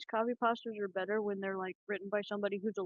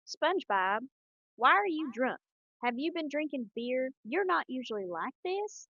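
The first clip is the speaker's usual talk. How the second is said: higher, slow Texas drawl; Texas squirrel accent; 5 semitones higher, 3 dB louder, slower than usual. Acted. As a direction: scold in a cartoon Texas accent. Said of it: "best Texas squirrel accent".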